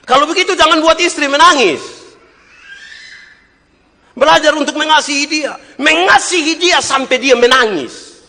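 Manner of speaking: high, loud preacher's cry; fiery sermon voice; full-force preaching. Acted. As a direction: drive it home to the congregation.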